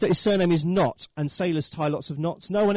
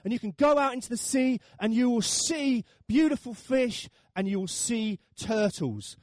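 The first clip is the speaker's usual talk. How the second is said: high-pitched imitation of a tour tout; boatman's sales pitch; 8 semitones higher than usual. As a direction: coax tourists aboard, stretching out the attractions. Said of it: "Uh, he says".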